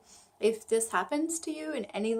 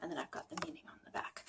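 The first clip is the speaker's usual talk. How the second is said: whisper; 4 semitones lower, 17 dB quieter, faster than usual. Real, not acted. Sudden whisper mid-explanation.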